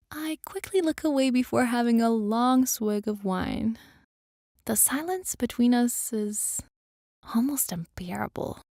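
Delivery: plain reading voice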